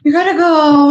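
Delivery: high sing-song praise for a pet. Real, not acted. Praising her dog.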